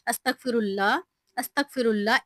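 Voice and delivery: अस्तगफिरुल्लाह said slowly, each syllable clear; slow careful recitation. Shown as a demonstration. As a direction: take your time over every part of अस्तगफिरुल्लाह. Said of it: "आपने आराम-आराम से पढ़ना है". (आराम-आराम से, एक-एक लफ्ज़ साफ़ अस्तगफिरुल्लाह)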